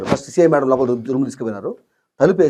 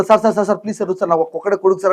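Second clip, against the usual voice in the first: desperate pleading; 6 semitones higher than usual. Retold or imitated. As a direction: beg the officer frantically. Father begging the officer. (ఆత్రంగా వేడుకోవడం)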